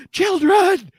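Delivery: put-on high, loud cry to children; panicked call. Game master voices panicking Henrietta.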